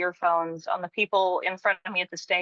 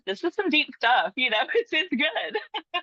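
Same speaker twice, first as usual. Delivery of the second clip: laughing; 8 semitones higher than usual. Real, not acted. Laughing while praising deep talk.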